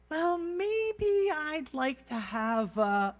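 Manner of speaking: very high, drawn-out dithering voice; hammed-up indecisive wife at a restaurant; Monica. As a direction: stretch it out in a squeaky falsetto. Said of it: "that's my best Monica impersonation".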